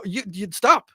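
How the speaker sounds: usual speaking voice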